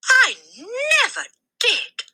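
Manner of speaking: very high frightened cry; exclaimed. A narrator's reading. The boy cries out denying to the headmistress. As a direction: cry out in panic. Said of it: "the boy exclaimed".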